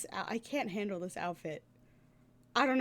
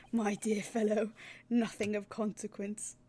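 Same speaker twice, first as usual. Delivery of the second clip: ordinary talk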